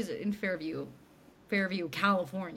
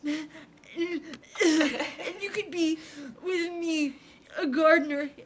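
high, loud fake crying; hammed-up tearful begging, pitched high; mock sobbing plea; 7 semitones higher, 5 dB louder than usual. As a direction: blubber through the plea like a soap star. Retelling John's tearful plea.

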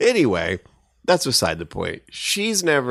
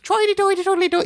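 very high British-sounding nonsense; mock Mary Poppins British; faster than usual. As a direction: trill silly British gibberish way up high. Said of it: "like Mary Poppins. And goes".

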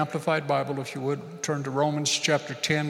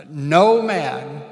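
emphatic call; 8 dB louder than usual. Shown as a demonstration. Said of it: "everybody say".

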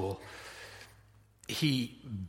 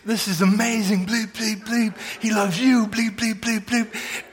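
high, loud, rapturous shouting with bleeps; loud, high-pitched mock swearing tirade; excited cussing yell; 8 semitones higher, 4 dB louder than usual. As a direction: imitate an overjoyed man yelling censored curses. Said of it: "he's yelling these things at huge volumes".

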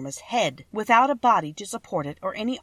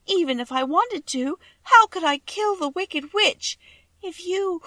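tearful pleading; 11 semitones higher, 5 dB louder than usual. A narrator's reading. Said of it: "she sobbed".

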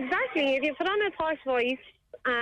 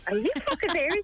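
imitating an astonished friend, higher pitched; jaw-dropped disbelief; 6 semitones higher than usual. Friend hearing her phone voice, imitated disbelief. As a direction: retell it in high, incredulous questioning. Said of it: "and the jaw hit the, hit the floor".

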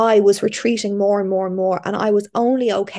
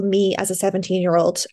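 normal talking voice

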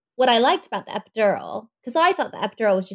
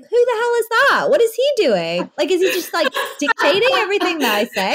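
high, louder, incredulous questions; retold bewildered outburst; 8 semitones higher, 3 dB louder than usual. Asking about the man with the computer, in high disbelief. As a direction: play up the disbelief, pitched high.

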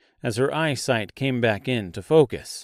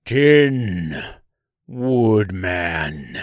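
drawn-out creature cry, loud at first; monster's scream; 4 semitones lower, 4 dB louder, much slower than usual. A narrator's reading. Giant tree recognizing its worst enemy, drawn-out scream. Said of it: "it screamed".

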